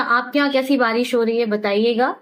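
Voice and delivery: ordinary talk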